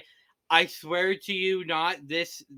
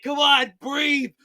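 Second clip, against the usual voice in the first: high, loud mock desperation, building to a squeal; overly dramatic CPR plea; 8 semitones higher, 9 dB louder, slower than usual. Host acts dad reviving his daughter, hammed-up loud plea. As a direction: beg them to breathe, hammed up and rising. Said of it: "overly dramatic C P R scenes".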